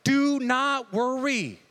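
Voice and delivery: slow it down, do not worry; slow, earnest plea; Jesus speaking gently. Retold or imitated. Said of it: "He's like".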